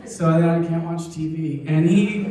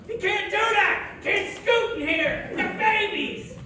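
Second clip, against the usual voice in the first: high-pitched mock scolding wife; 13 semitones higher, 4 dB quieter than usual. Wife scolding the scooting dog, high mock outrage. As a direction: yelp it like a wife shocked at the dog.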